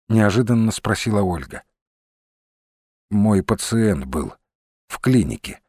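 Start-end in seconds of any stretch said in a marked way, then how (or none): 3.1-5.6 understated, slightly slowed explanation; restrained. A narrator's reading. He explains a past patient to Olga, restrained. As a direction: hold it back, giving little away. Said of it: "сдержанно объяснил он".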